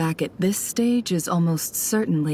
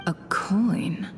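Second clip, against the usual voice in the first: whispered; 3 dB quieter than usual. Acted. Spy's inner thoughts noticing coin.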